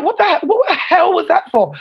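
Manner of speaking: mock-indignant cry of surprise; shocked outrage. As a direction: demand an answer, stunned by the hit. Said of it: "you'd be like".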